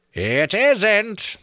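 high, slow creaking taunt; creaking skull voice. A narrator's reading. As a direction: creak and knock like branches in wind. Talking skull correcting.

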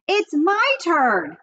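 slow, high demand; insisting. A narrator's reading. Fern demanding her turn from Avery, slow and high. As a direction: stretch it out insistently.

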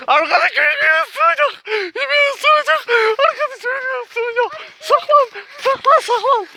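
very high, wailing panic; terrified crying. Real, not acted. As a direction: cry out in terror. Crying that the dog will bite.

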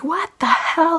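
high, startled outburst; high-pitched stunned exclamation; shocked what the hell. Retold or imitated. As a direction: blurt it out in disbelief. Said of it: "So I was like".

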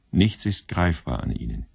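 ordinary talk

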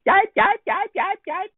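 very high yelping puppy cries; dragon yelping. A narrator's reading. Scared dragon runs into the trees, yelping very high. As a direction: yelp like a frightened little dog.

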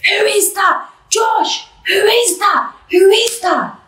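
put-on yelling at a boyfriend caught on camera, shrieking high; screaming. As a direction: scream it, raw disbelief. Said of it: "I'm screaming".

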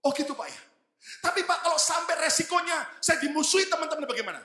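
high, half-laughing worried voice; worried church member. Retold or imitated. Church member fearing his coworkers, high nervous worry. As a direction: raise the voice and fret with a nervous laugh. Congregant worries back to pastor.

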